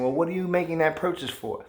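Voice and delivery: high, pushy nagging wife voice; mock nagging wife. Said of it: "without her saying".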